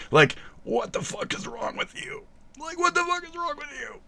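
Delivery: very high, squeaky disbelief; high-pitched, hammed-up exasperation; mock exasperation. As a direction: squeal it high, without much volume. Acted rant at the viewer.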